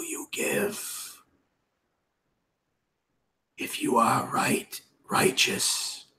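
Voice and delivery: slow, hoarse whispered menace; Wraith voice. Acted. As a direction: breathe it out like a wraith. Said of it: "in the Wraith voice".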